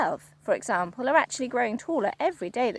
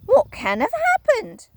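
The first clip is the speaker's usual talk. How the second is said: put-on much higher, louder worried old woman; Missus Twit, alarmed; 12 semitones higher, 7 dB louder than usual. Wife staring at her stick, high alarm. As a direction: squeak it out in alarm. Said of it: "Missus Twit said".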